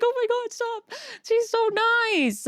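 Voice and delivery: imitating herself melting at kindness; gushing retold reaction. Streamer retelling meeting a sweet friend, gushing high. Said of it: "And I was like".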